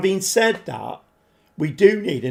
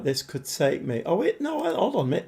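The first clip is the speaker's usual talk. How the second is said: ordinary delivery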